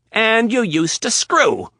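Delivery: high, loud, slow; outraged accusation. Acted. Riley outraged at Rapp, high and loud. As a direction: hurl it.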